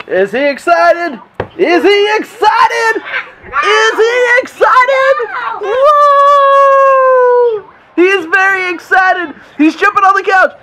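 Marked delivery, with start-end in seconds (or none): 1.6-5.1 high, slow, loud hype call; hyped-up announcer. Shown as a demonstration. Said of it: "building play-by-play hype". 5.6-10.5 high, loud sportscaster voice; play-by-play commentary. Acted. Dad commentates pillow fight.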